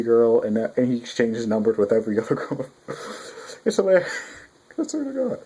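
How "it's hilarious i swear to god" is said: high, laughing through the words. Real, not acted. Vlogger cracking up about Kumagawa, laughing high. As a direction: laugh while insisting it's true.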